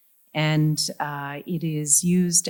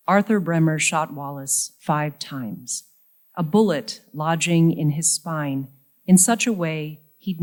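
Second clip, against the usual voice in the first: measured poem recital with deliberate pauses; poetry reading; 5 dB louder than usual. Acted. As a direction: read it line by line, letting each phrase land. Reads poem about father.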